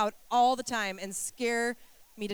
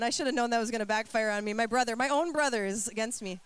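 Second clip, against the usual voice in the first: usual speaking voice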